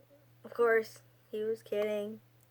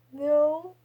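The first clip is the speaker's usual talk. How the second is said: tearful denial; 6 semitones higher, 8 dB louder than usual. Retold or imitated. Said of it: "I started crying on the phone".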